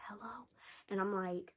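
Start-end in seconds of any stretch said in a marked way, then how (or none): 0.1-0.5 breathy whispered phone hello. Retold or imitated. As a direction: whisper it like a secret call. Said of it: "But imagine I'm whispering".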